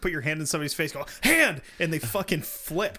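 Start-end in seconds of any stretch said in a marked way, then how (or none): 1.2-1.6 high, loud one-word yell; mock yell. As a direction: shout it right in their face. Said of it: "You could yell".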